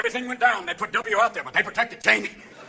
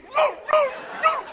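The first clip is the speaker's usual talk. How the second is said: high-pitched dog woofs; short, high barking woos; Lassie; slower than usual. Acted. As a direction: imitate an alert dog's yelps. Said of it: "Lassie who would go".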